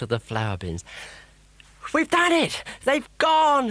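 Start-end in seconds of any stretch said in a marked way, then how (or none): 1.9-3.7 high, loud joyful shout; triumphant cheer. A narrator's reading. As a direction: celebrate the victory at the top of your voice. Boy celebrates victory.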